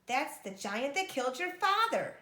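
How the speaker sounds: loud, high horrified outcry; horrified cry. Acted. Mother seeing the dead giant, horrified cry. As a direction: cry out in shock. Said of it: "cried out in horror".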